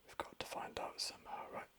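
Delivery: soft, voiceless ASMR whisper. Real, not acted. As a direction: drop right down to a confiding whisper. ASMR video, drops into whisper.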